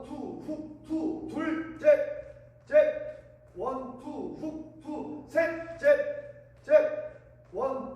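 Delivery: rhythmic shouted workout calls; boxing coach calls. Shown as a demonstration. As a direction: count the punches like a coach.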